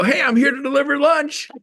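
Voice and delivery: high, bright upbeat announce; high cheerful delivery guy. Retold or imitated.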